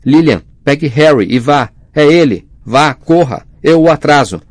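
urgent shout. A narrator's reading. Husband shouting to wife to flee, urgent loud. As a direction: yell at her to run, high and loud. James warns Lily, Voldemort arriving.

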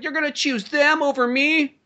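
high, drawn-out mock disbelief; indignant girlfriend. Mimicking girlfriend's indignant question.

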